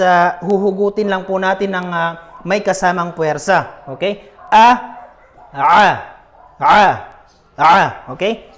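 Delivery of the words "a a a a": A pushed up from the throat, louder and thick; forceful ain. Shown as a demonstration. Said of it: "mas malakas po siya sa pagbigkas at mas makapal". (A na hinuhugot sa lalamunan, malakas at makapal)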